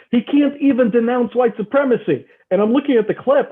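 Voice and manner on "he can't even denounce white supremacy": mocking the friend. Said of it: "sends it to me and goes".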